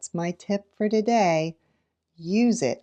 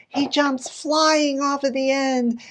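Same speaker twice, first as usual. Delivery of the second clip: slow, higher dramatic reveal; big reveal; 8 semitones higher than usual. Shown as a demonstration. Teacher reading the climax to kids, dramatic reveal. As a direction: land the climax with flair. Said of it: "the big reveal".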